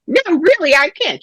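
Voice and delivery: high-pitched acted protest; retold polite but strained no; insistent refusal. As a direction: fend off a pushy helper, voice jumping up. Acting out refusing a pushy healer.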